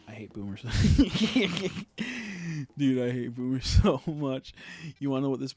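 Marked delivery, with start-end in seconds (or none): 0.7-2.7 loud burst of real laughter; laughing. Real, not acted. Laughing out loud at his own boomer rant. 2.8-4.5 louder, laughing through the words. Real, not acted. Ranting to his audience about boomers, laughing.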